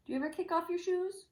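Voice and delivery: ordinary delivery, nothing marked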